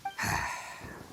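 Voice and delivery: long unvoiced sigh; weary sigh. Weary sigh before telling the outsiders the story. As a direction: breathe out heavily before the tale.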